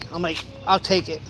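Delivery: normal talking voice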